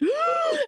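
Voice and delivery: sharp high gasp before a speech; big dramatic gasp. Retold or imitated. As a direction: suck in a huge theatrical breath. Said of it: "takes this big breath".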